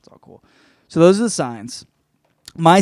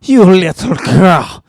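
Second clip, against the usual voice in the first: put-on over-the-top Eastern European accent, teasing; mock Slavic accent; 2 semitones higher, 7 dB louder than usual. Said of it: "it's a Slavic deep".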